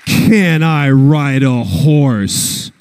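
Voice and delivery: very slow, self-assured quoted reply; slow cocky drawl. Retold or imitated. Actor bluffing to casting about riding, slow cocky. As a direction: stretch it out like a smug Texan. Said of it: "I said".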